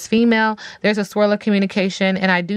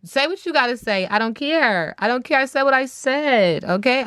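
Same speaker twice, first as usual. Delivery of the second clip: sassy defiance; 6 semitones higher than usual. Real, not acted. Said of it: "sassy defiant declaration".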